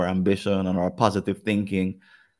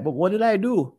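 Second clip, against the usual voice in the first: mock bewildered husband; 12 semitones higher than usual. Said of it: "and you're like".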